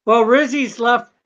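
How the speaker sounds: ordinary delivery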